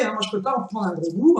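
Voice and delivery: talking through sticky candy; words stuck in the teeth; chewing sticky toffee. Real, not acted. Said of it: "Oh, que ça colle aux dents".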